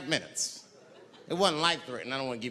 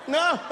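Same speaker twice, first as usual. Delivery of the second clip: horrified shriek into laughter; 12 semitones higher than usual. Acted. Victim gets hit.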